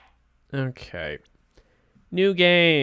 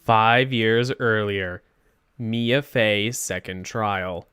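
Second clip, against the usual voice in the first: slow, lower title-card reading; caption narration; 4 semitones lower, much slower than usual. Acted. Narrator setting up a flashback trial, slow and low. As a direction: announce the flashback slowly and deliberately.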